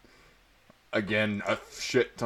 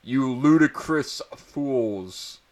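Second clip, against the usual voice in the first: louder, slow scolding; slow mock-furious headmaster; angry shout; 5 semitones higher, 6 dB louder, much slower than usual. A narrator's reading. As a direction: drag out each insult. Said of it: "He kept shouting at us angrily".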